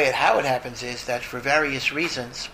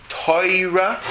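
Toira, the Torah vowel as an oy, sung out higher; a Yiddish Toira with an oy in the middle, lifted up; 6 semitones higher, 4 dB louder than usual. Shown as a demonstration. Rabbi showing the Polish-Yiddish Toira, higher and louder. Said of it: "the word Torah can be".

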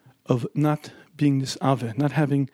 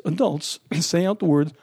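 normal talking voice

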